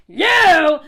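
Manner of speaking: very loud, drawn-out accusing word; sudden accusing yell; pointed shout. Real, not acted. Turns on the commenter.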